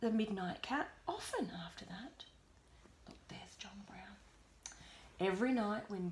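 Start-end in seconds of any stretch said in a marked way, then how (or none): 1.1-4.2 soft whisper. Real, not acted. Aside to child while reading.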